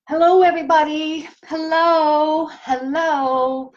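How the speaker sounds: bright, high, quick greeting to a crowd; stage greeting. Retold or imitated. Gaia walking on stage greeting the crowd, high showman hello. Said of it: "pretending like she's walking on stage".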